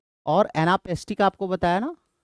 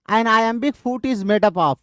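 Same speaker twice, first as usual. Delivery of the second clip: slow and higher question read; 4 semitones higher, much slower than usual. Shown as a demonstration. Teacher reading the iambic question, slowly and higher. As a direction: read it out line by line.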